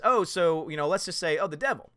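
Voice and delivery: ordinary talk, nothing marked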